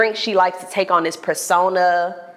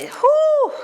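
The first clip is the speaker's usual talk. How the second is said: whew. Retold or imitated. Retold friend's exclamation.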